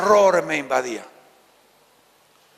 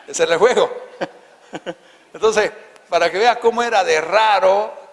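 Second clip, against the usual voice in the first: chuckling through the words, amused; laughing; 5 semitones higher, 6 dB louder than usual. Real, not acted. Remembering the street game to listeners, laughing. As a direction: laugh it out while telling the game.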